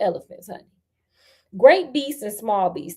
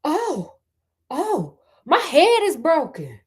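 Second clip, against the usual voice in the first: higher, slow pained wail; crying out; 5 semitones higher than usual. Acted. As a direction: wail like your head just cracked. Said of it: "heard the elephant cry out".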